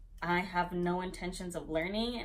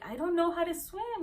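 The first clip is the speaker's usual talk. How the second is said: high-pitched mock panicked plea; pitched-up imitation of panic; retold panic; 10 semitones higher than usual. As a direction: re-enact a panicked protest, voice jumping up. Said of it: "panicking and splashing everywhere".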